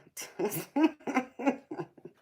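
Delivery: a bright little laugh up high; short high laugh; high giggle. Real, not acted. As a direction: giggle at the comment. Reacting to a chat joke.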